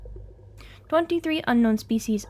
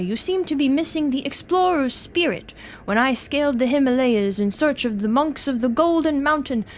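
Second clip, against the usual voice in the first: indignant British explorer, forcefully voiced; Lord Blackwood; 4 dB louder than usual. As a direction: defend your honour in a hammed-up British accent. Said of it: "Should I attempt a British accent".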